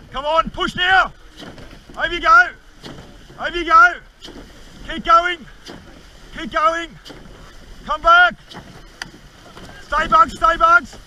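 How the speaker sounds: straining, high, full-throated yelling; shaking with effort. Real, not acted. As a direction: bellow commands with everything you have. [name] straining through big wave.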